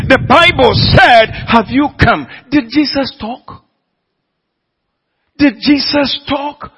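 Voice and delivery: preaching at full force. Acted. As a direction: hammer the congregation, rising to a peak.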